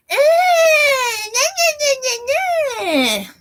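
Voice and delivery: Sherelle Clark. Retold or imitated. Taunting in the street after a row, a shrill jeer. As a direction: screech nah-nah like a jeering neighbour. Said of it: "you sound exactly like Sherelle Clark".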